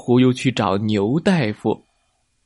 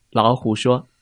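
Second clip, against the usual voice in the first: ordinary delivery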